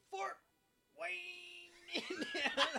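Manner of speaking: mock high pitch. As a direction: squeak the place name as a joke. Joking about Fort Wayne.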